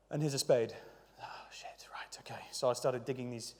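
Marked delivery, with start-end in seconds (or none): 1.2-2.4 under-the-breath whisper; a whispered oh no to yourself; whispered dismay. Retold or imitated. Reacting to the huge digging job.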